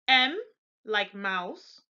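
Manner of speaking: ordinary talk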